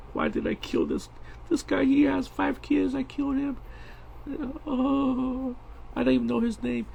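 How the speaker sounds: high, wailing fake sob; a hired killer weeping over her victim; crying assassin. Drawn character weeping over the man she killed, high mock wail. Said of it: "I'm trying to make her cry".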